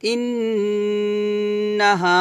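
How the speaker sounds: ইন্নাহা with a held nasal ন্ন and a long হা; nasal ghunna. Shown as a demonstration. Teacher reciting ইন্নাহা with ghunna and madd. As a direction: hum the ghunna, then stretch হা, slowly. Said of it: "এটা ওয়াজিব গুন্না".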